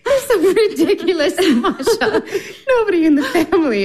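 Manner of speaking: amused sister laughing. Acted. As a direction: tease her through giggles. Olga laughing at Masha.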